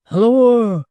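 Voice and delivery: loud high call down the passage; a loud hail. A narrator's reading. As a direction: shout it into the dark. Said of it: "cried Hugh".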